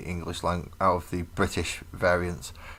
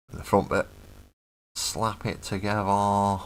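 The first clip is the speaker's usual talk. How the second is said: British regional accent slips out; 2 semitones higher than usual. Real, not acted. Talking through the model build, British accent slipping out. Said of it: "a bit of the accent came out there".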